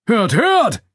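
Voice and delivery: loud, very high crowd call; heckling shout. A narrator's reading. Spectator reacts to the boast, calling out. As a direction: shout it mockingly from the audience. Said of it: "rief einer der Zuschauer".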